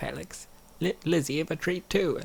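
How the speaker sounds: ordinary talk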